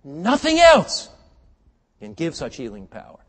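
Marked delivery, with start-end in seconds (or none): 0.0-1.1 very high, drawn-out preacher's shout; emphatic shout. Real, not acted. Preacher insisting on scripture's power, drawn-out high shout. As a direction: hold both words long and loud. Repeats the claim at full force.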